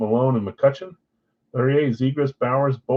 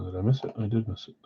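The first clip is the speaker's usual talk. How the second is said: usual speaking voice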